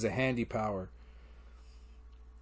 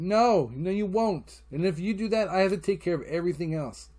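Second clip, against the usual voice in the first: higher-pitched retold argument; protesting, voice pitched up; exasperated rebuttal; 5 semitones higher than usual. Dad rejecting girlfriend's promise, exasperated. Arguing against another baby.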